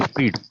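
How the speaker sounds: स्पीड said loudly with an r after the p; correct pronunciation, spread. Shown as a demonstration. Teacher repeats the right reading after spelling, स्पीड with an r. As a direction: land firmly on स्पीड as "spreed", r included. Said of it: "स्पीड पढ़ लीजिएगा इसको".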